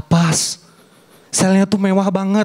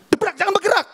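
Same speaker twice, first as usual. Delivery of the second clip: officer yelling; 12 semitones higher, faster than usual. Retold or imitated. Raid officer shouting.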